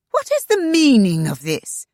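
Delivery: high, loud indignant demand. A narrator's reading. Shanna confronting the agent, loud and indignant. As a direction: demand an answer, outraged. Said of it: "Shanna demanded indignantly".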